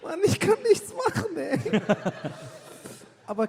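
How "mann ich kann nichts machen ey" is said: lachend. Real, not acted. Nach Lachanfall, noch atemlos.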